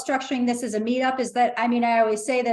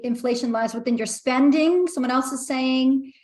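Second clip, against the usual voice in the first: normal talking voice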